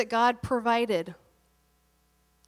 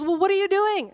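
higher and louder, a blunt pointed question; pointed friend's challenge; 9 semitones higher, 4 dB louder than usual. Retold or imitated. Friend challenging the preacher about his calling, pointed and raised. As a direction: call him out like a no-nonsense friend. Friend Dana confronting him, retold.

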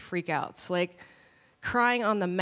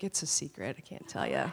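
much lower, softer, playful dad voice; a sly dad keeping a secret; low teasing secret; 7 semitones lower, 8 dB quieter than usual. Retold or imitated. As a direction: coyly refuse to tell. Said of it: "He's like".

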